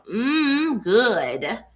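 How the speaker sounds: high relishing mm, then good; savoring mm good. Shown as a demonstration. Coach savoring her own title, a playful mm good. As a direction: savor it like a tasty bite. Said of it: "that's how I like to say it".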